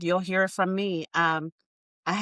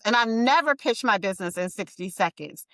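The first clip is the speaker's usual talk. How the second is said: ordinary talk, nothing marked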